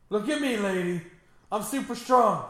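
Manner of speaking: high, loud boastful mock hero; mock hero brag. Voicing the strong hero bragging to a lady. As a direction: puff up like a showoff superhero.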